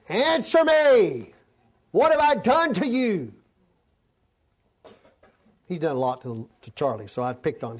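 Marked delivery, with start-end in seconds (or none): 0.1-1.3 higher, louder, slow plea; drawn-out divine plea, answer me; the Lord pleading with his people, higher and slow. Acted. God voiced pleading in sermon. 1.9-3.3 voice of God pleading. Acted.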